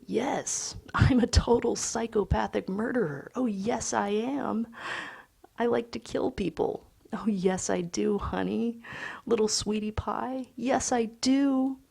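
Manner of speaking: put-on high sing-song baby voice; baby talk. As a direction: coo it to a toddler. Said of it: "talking in a high-pitched baby voice".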